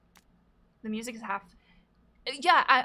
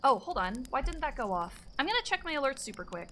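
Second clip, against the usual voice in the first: ordinary talk, nothing marked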